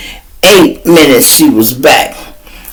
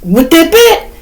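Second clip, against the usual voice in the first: incredulous question. Retold or imitated. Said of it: "Sean said".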